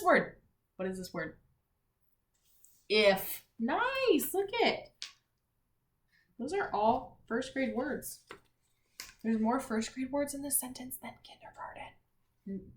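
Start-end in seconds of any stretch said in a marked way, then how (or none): 3.6-4.9 excited. Acted. Praising the class. 9.2-12.7 confiding teacher whisper; hushed aside to the class. Acted.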